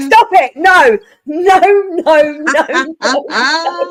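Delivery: high, loud laughing stop it; laugh-yell at them to stop; loud giggling no no no; loud laughing protest. Retold or imitated. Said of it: "No, I said".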